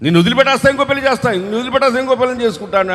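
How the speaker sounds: high, fast, rattled-off threat; mock husband snapping at wife; husband's threat. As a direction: fire off the threat like a callous husband. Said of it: "అనేవాళ్ళు లేకపోలేదు".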